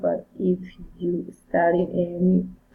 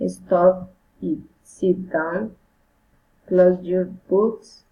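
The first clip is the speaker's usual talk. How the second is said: firm orders, tune falling; falling command; 3 dB louder, slower than usual. Shown as a demonstration. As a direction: give the command firmly, drop at the end. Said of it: "Commands fine intonation".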